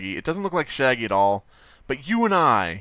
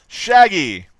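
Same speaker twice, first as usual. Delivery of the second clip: excited shout; 7 semitones higher, 11 dB louder than usual. Real, not acted. Calling out the character's name.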